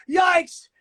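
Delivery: high, loud mock yelp; alarmed yelp. Rookie dropping in wrong, mock frightened yelp. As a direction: squeal in fright as the wave pitches. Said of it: "I went".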